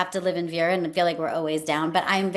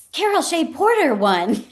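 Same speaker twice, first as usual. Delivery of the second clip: higher, louder announcing a win; excited news; 10 semitones higher, 5 dB louder than usual. Retold or imitated. Colleague on cell phone announcing surprise win, excited. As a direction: burst out the good news. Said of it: "he said on the phone".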